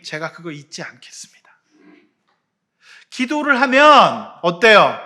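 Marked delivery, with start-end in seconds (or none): 0.0-1.4 hushed, breathy vow; whispered prayer. Pretend. Preacher acting out a believer's prayer, whispered. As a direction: whisper it like a secret promise.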